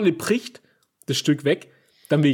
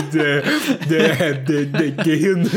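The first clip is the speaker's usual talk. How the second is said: ordinary delivery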